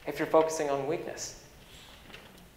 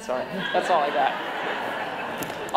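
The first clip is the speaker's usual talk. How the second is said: normal talking voice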